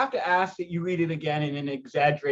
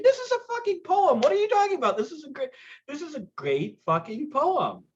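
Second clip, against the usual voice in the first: excited; 12 semitones higher than usual. Real, not acted. Excited praise of the poem.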